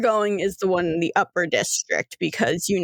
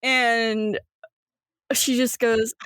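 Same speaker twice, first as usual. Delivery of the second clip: normal talking voice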